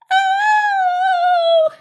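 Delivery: loud, drawn-out squeal of pain; high pained cry. Acted. Mouse bopped on the head, long high yelp.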